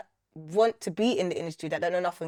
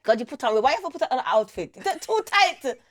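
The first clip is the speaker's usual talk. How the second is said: high, exasperated mock scolding; hammed-up motherly outrage; imitating mum; 10 semitones higher than usual. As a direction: play up mum's appalled complaint, rising in pitch. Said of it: "Always put this accent".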